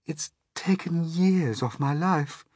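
high, slow ladybird lament; high-pitched, drawn-out character voice. Acted. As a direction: complain slowly in a high voice. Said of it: "the ladybird said".

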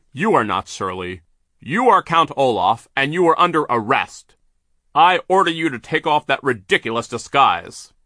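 firm, loud arrest order; Mister Poe confronting the disguised villain, stern; stern banker. Acted.

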